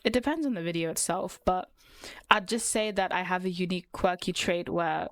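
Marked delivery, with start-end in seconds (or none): none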